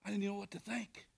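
soft whisper; hushed confession. Real, not acted. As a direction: drop to a whisper. Whispered after pause.

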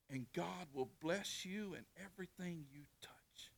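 whispered blessing. Acted. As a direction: breathe the promise in a whisper.

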